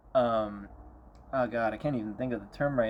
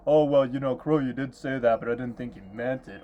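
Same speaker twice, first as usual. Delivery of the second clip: whiny excuse; 5 dB louder than usual. Retold or imitated. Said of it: "It's always".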